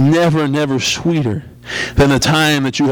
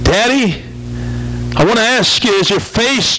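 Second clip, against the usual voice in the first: put-on much higher, a small boy asking in the dark; little boy's voice; 9 semitones higher than usual. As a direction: voice the little boy, pitched up and timid. Child voiced in story.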